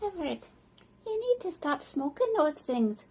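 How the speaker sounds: a gently pleading wife, lightly voiced; worried wife. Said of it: "she said".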